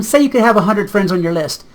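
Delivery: normal talking voice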